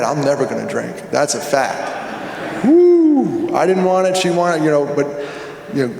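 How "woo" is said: high, loud, drawn-out woo; loud whoop. Real, not acted. Whooping after divorce remark.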